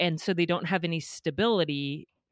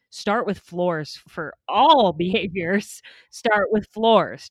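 slow and louder on "floors"; emphatic; 5 dB louder, slower than usual. Real, not acted. Host drives home the podcaster's point, slow and louder. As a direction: press each word of "start with floors". Host stresses the key idea.

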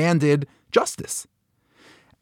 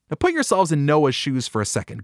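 ordinary talk

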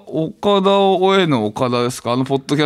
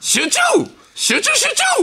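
mock shout. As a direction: yell it like a cheering coach. Mock urging focus. (高い声で叫ぶ掛け声)

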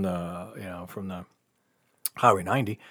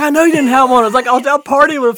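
loud, high retort; loud, telling friends where he partied; exasperated yell; 13 semitones higher, 7 dB louder, faster than usual. Retold or imitated. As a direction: shout it at your friend.

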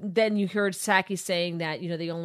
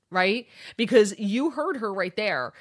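ordinary talk, nothing marked

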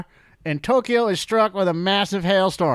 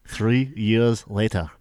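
low, slow trailer announcer; deep movie trailer voice; 9 semitones lower, slower than usual. Shown as a demonstration. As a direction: rumble it like a trailer voice. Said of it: "I can't do it".

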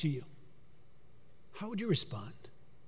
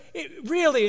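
stunned disbelief; 9 semitones higher than usual. Retold or imitated. Angel hearing of her promotion, shouting in disbelief. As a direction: pitch it up as if you can't believe it. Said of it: "he said".

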